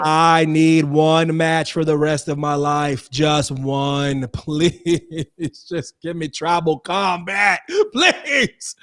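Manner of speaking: slow plea breaking into laughs; hammed-up pleading. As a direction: beg slowly, stretching please, half laughing. Begs for one match.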